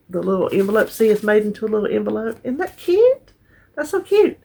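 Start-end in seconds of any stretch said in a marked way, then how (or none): 2.4-4.4 much higher, gushing delight. Real, not acted. Showing viewers a gift card, gushing delight. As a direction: squeal over how cute the card is.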